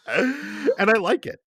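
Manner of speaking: high and slow, savouring it; a giddy confession; high drawn-out glee. Real, not acted. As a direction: stretch it out gleefully. Gleeful admission about the game.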